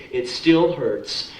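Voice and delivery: ordinary talk, nothing marked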